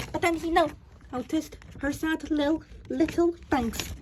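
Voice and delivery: ordinary delivery, nothing marked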